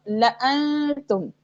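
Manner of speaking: slow "लअनतुम" with short la; silent-alif recitation. Shown as a demonstration. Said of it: "ये सारे अलिफ जो हैं ... नहीं पढ़े जाएंगे".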